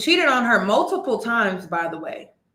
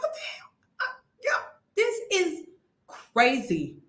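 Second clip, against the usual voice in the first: high and much softer, almost breathless; stunned high disbelief; 6 semitones higher, 5 dB quieter than usual. Retold or imitated. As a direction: let the shock squeeze the voice. Reactor retelling own stunned reaction.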